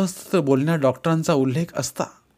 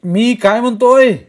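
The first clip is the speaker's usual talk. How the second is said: loud, higher, slowed yell; shouting on phone; 9 semitones higher, 9 dB louder, slower than usual. A narrator's reading. Kumar yelling at Vaibhav over the phone. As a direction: bellow the question down the line. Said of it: "Kumar ओरडलाच फोनवर".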